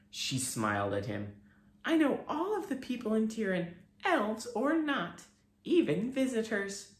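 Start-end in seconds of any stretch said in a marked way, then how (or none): 1.8-6.9 very high, gentle grateful fairy; fairy thanking her rescuer; tiny fairy voice. A narrator's reading. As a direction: thank the boy sweetly and tinily.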